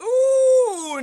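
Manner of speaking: high, loud impressed ooh; excited ooh at good news. Real, not acted. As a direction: let out a delighted ooh.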